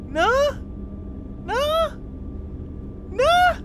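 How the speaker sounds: shrill rising no; cartoonish high-pitched pleading; squeaky high disbelief. Nobody gets the joke.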